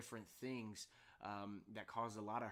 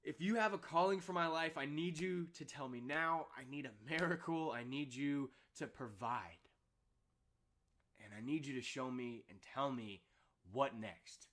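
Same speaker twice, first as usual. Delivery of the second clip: louder, higher desperate plea to God; breaking down in prayer; 3 semitones higher, 6 dB louder than usual. Retold or imitated. Alone after the phone call, crying out loud to God. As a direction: beg God for a sign, voice pushed up. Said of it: "I just broke down".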